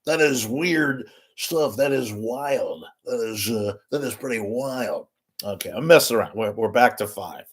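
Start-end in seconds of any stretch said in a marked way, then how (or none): 0.0-5.1 impersonated personality. Acted. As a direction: drag out each word like a catchphrase. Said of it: "remember that guy?".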